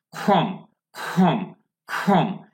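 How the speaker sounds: খম with a throaty, aspirated kh at the start; glottal kh. Shown as a demonstration. Teacher drilling a glottal sound for learners, throaty kh. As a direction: say খম from the throat, each one separate. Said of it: "glottal থেকে".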